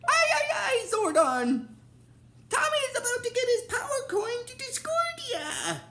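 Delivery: very high-pitched panicky robot impression; shrill-pitched character squeal, words tumbling out in alarm; Alpha. Retelling Alpha's alarm to Zordon, high squeaky impression. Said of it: "Alpha realizes that he's like".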